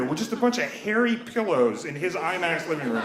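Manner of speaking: ordinary delivery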